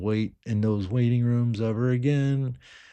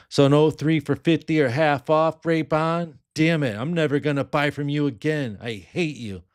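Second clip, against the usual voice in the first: louder, higher fake complaint; mock outrage; 6 semitones higher, 5 dB louder than usual. Joking to seller Ray about no discount, mock angry. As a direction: playfully scold the seller.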